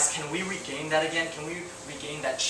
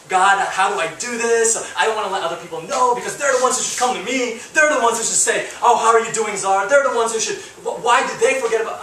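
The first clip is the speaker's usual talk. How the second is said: higher and louder, aggrieved whining to God; self-pitying complaint; 6 semitones higher, 6 dB louder than usual. Retold or imitated. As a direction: sulk that nobody asked after you. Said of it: "I was having all this selfishness".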